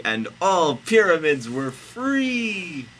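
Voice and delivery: high, loud, slow narration; big storyteller's climax; triumphant finale. Acted. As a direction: draw out the ending triumphantly.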